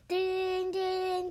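high, loud mock phone ring; phone ring imitation. Retelling the story, mimicking the phone ringing loudly.

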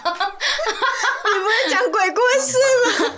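slow, high, laughing disbelief; laughing protest. Real, not acted. Cohost laughing that it was meant to be a ghost story. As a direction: laugh out the complaint.

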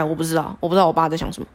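ordinary talk, nothing marked

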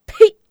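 explosive, high, loud "pah"; contemptuous spit. A narrator's reading. Son spurns the villain's offer of rank, contemptuous spit. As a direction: spit it out in scorn. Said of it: "鄙视地唾道".

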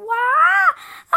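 short loud wailing sobs; crying wail. Acted. Princess crying harder, loud wails. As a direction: let out bursts of crying.

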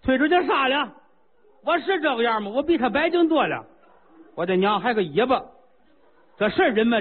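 high, indignant royal voice; a king spluttering with outrage; ranting king. Acted. King rages at the newborn.

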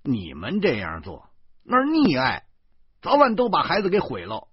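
high, loud haranguing lecture; heated, preachy tirade; relentless shouting. Acted. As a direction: rail at them without letting up. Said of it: "我不依不饶地喊着".

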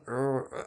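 mock strained yelps. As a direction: imitate stifled yelps on the slide. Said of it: "发出一个不太雅观的叫声".

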